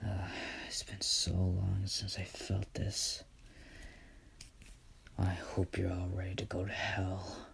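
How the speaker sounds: hushed, gloating villain voice; menacing villain whisper. Acted. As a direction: whisper it like a villain back from hell. Villain speech in a story.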